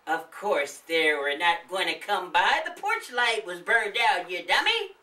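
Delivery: high, nagging wife's voice; scolding old woman. Acted. The old woman scolding her husband about the candy, high nagging. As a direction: tell off your lazy husband.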